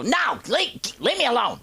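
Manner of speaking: high-pitched mock snapping; high, put-on cross voice; mad old mother. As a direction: imitate an irritated mom refusing help. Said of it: "she was mad at me".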